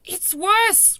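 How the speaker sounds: high, very loud insistence; loud cry. A narrator's reading. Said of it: "cried the B F G".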